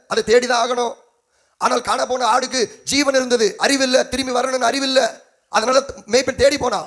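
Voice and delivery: high, insistent pulpit voice; impassioned preaching. Acted. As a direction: drive each point home to the congregation. Preaching at full force.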